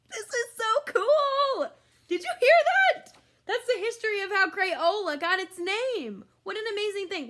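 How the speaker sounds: squealing excitement; thrilled. Real, not acted. Thrilled aside after the name reveal.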